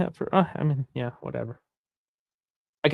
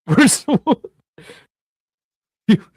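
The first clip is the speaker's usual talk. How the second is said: laughing; 11 semitones higher, 7 dB louder than usual. Real, not acted. Disbelief at a chat message.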